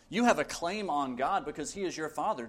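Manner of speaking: ordinary talk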